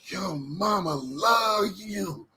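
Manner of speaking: very slow, drawn-out Gump voice; slow simple-man Gump impression; Forrest Gump quote. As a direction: stretch every word like Gump. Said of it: "he say".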